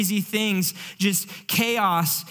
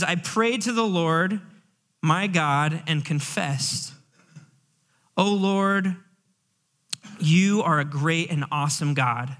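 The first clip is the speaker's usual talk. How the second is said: scripture prayer reading; 3 semitones lower than usual. Acted. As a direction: read it like a confession before God. Reads Daniel's prayer aloud.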